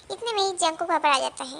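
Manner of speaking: ordinary talk, nothing marked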